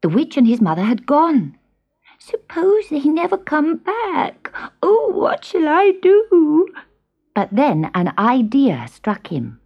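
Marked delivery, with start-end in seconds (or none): 2.2-4.5 high, slow whispering kitten; frightened whisper. A narrator's reading. Kitten alone and scared. 4.8-6.7 high, slow fretting kitten voice; a small lost kitten asking; worried kitten. A narrator's reading. Kitten alone and scared.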